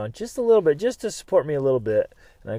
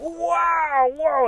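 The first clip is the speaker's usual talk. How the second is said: high yelp of pain; 4 dB louder than usual. Retold or imitated. Doctor taps the cut nerve, high pained yelp. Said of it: "and I was like".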